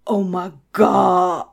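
slow, drawn-out stunned exclamation; mock amazement. Cinderella staring at the stranger in her kitchen, mock amazement. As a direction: stretch it out in staged astonishment. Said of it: "she was so surprised".

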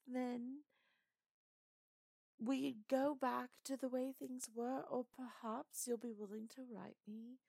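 put-on flirtatious lightly done British accent. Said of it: "so I kinda wanted to try and do British".

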